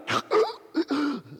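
sharp, high intake of breath; shocked sudden gasp. Shown as a demonstration. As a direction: gasp as if jolted back to life. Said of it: "was it shocking and sudden?".

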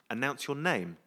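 ordinary talk, nothing marked

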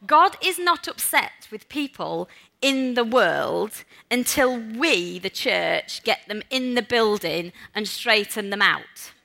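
slow, deliberate reading, each word given room; read slowly. Shown as a demonstration. Preacher reading a key line to the congregation, slow and deliberate. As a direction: let every word land so they hear it. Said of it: "I'm going to read this slowly because I want you to hear this".

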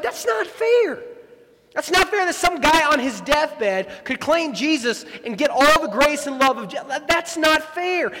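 high, loud whiny outrage; indignant protest. Retold or imitated. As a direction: play the wronged inner child. Our inner voice protesting unfairness.

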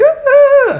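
very high, loud mock wail; imitated lady's cry. Surfer imitates a drowning lady heard behind her, mock high cry. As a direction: imitate a woman's shrill-pitched scream. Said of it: "I hear a lady's voice".

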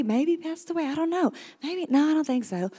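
put-on small child thinking aloud. Kids guessing, voiced child.

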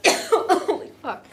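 coughing fit with a laugh; laughing and coughing after swallowing wrong; choking on water. Real, not acted. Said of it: "Eyes watering".

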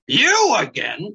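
slow grumpy weasel growl. Acted. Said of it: "growled the weasel".

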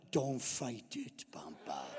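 slow, hushed, low voice; mock hypnotic whisper. Granddaughter working fairy-dust magic on grandpa, slow mock-mystical whisper. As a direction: whisper it slowly like casting a spell. Child casting a spell on grandpa.

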